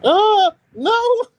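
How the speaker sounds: loud helpless laughter; laughing hard. Real, not acted. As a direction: crack up at the picture. Sees the picture, bursts out laughing.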